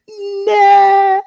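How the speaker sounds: hammed-up anguished shriek; Luke's scream. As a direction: wail it like Luke in despair. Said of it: "Mark Hamill in the studio".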